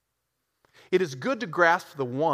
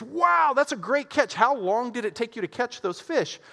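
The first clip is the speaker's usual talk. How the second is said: amazed businessman; 9 semitones higher than usual. Acted. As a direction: gush at the catch like a keen businessman.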